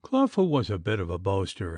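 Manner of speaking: ordinary delivery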